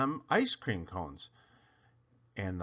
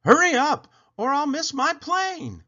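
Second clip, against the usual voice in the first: much higher and louder, hurried; impatient plea; 7 dB louder than usual. A narrator's reading. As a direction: urge the driver on, frantic. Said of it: "she told the taxi driver".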